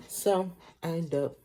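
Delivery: ordinary talk, nothing marked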